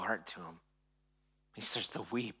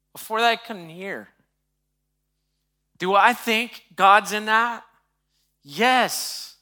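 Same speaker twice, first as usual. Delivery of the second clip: higher, emphatic pulpit voice; impassioned preaching; 8 semitones higher, 10 dB louder than usual. Real, not acted. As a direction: press the point home to the congregation, raised and forceful.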